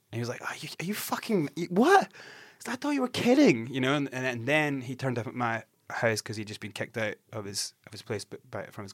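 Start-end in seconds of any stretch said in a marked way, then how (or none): 0.4-3.7 high-pitched mock disbelief, building louder; incredulous. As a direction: splutter it out, voice leaping up in shock. Acts out friend's incredulous reply.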